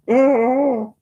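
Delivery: sharp short cry of frustration, high and loud; a real yelp of annoyance at the snag; frustrated cry. Real, not acted. Snagged wool, frustrated cry.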